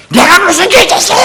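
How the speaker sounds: high, loud, indignant protest; offended churchgoer. Acted. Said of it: "한번 제가 그래볼까요?".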